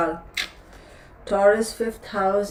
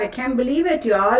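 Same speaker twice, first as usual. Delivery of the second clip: usual speaking voice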